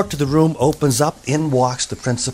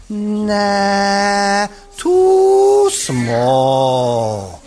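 long high "nah", then drawn-out swoop down; comic doctor stretching every vowel; dismissive doctor; 5 semitones higher, much slower than usual. A narrator's reading. Doctor rejects needle again.

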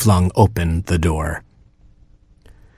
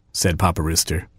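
ordinary delivery

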